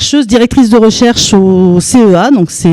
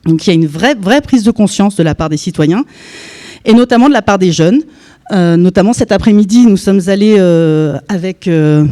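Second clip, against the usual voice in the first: ordinary talk, nothing marked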